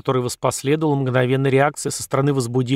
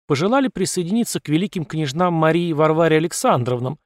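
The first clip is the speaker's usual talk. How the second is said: ordinary delivery, nothing marked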